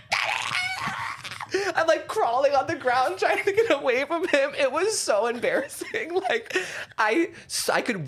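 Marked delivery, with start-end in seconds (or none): 0.1-1.7 very high-pitched squealed cry; retold shriek for help, thin and high; mock terrified scream. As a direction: squeal it in hammed-up panic. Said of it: "screaming bloody murder".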